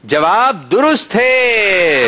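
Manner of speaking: drawn-out, rising shout to the crowd; triumphant announcement. Real, not acted. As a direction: proclaim it big, stretching every word. Quiz host announces a right answer.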